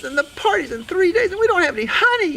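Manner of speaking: high, loud flustered mouse; squeaky worried mouse lady; fretting Sue Mouse. Acted. Sue Mouse frets about the party.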